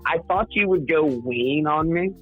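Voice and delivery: ordinary talk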